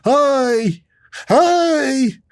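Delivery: high excited mock hello; squeaky eager hi. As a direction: chirp it like an overeager kid. Mocking impatient eager voice.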